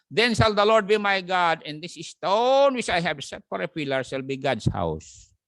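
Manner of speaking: slow, loud preaching read; proclaimed scripture. Acted. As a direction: declare each word of the verse slowly. (mabagal at malakas na pagbasa ng Bibliya)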